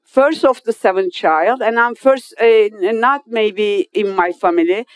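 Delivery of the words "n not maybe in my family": normal talking voice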